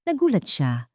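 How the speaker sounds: ordinary delivery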